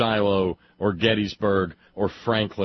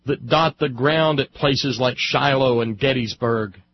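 loud, high speechifying; impassioned oratory; 9 semitones higher, 9 dB louder than usual. Real, not acted. Speaker orating on Civil War monuments and blood, impassioned. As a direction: drive every phrase home, loud and high.

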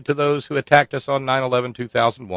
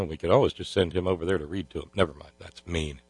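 ordinary delivery

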